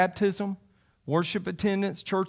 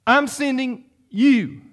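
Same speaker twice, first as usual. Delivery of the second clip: "I'm sending you" slow and higher, each part set apart; emphatic; 5 semitones higher, slower than usual. Acted. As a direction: punch "I'm sending you" slowly, with weight on "you". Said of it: "He says it emphatically".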